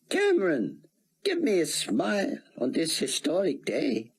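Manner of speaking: high, aged scholar voice, measured; an old academic bidding farewell; elderly scholar. Acted. Rosenzweig voiced again.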